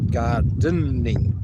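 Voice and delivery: very slow Australian "gardening", syllable by syllable; drawn-out Australian. Shown as a demonstration. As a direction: stretch "gardening" into separate broad Australian syllables. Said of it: "That's how Australian he is".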